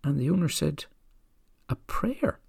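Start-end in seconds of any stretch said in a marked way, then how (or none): 1.7-2.4 high incredulous "A prayer?" leaping way up. Retold or imitated. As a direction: owner can't believe it, pitch jumps. Owner startled by the request.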